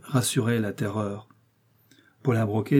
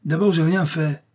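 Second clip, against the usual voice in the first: higher-pitched protest, mildly raised voice; a narrator's moderately loud indignant outburst; indignant cry; 6 semitones higher, 4 dB louder than usual. A narrator's reading. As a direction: cry out your innocence. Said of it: "cria la terreur".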